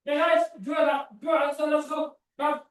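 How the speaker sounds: calls out to the class, high; calling out. Real, not acted.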